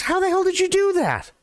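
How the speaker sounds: put-on stunned bartender asks the drunk again; astonished Joe. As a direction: blurt it, totally baffled.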